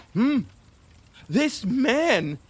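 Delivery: ordinary talk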